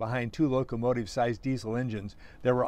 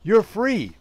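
high, loud narrated shout; shouted announcement; 8 semitones higher, 6 dB louder than usual. A narrator's reading. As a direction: shout it to someone deafened. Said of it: "and shouted".

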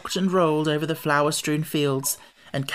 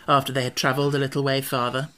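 ordinary delivery

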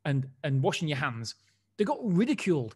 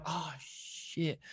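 very soft muttered curse; muted swear after his computer reboots; under his breath; 11 dB quieter than usual. Retold or imitated. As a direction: mutter it so nobody hears. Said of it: "I'm like".

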